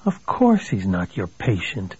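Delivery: a touch of giddy sarcasm; giddy inner thought. A narrator's reading. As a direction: think it to yourself, giddily and slowly. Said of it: "Billy thought giddily".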